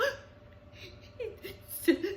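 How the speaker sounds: high, breathy whimpering gasp; baby bear's high gasp; whiny baby gasp. A narrator's reading. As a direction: gasp like a tearful little cub. Baby Bear gasps.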